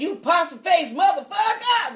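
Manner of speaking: slow mocking insult like Bernie Mac; Bernie Mac imitation. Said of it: "Bernie Mac was in Soul Man".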